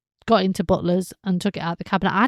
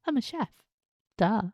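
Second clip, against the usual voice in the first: sarcastic duh; 2 semitones higher, 6 dB quieter than usual. Pretend. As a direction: say it like the answer is obvious. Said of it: "I just go".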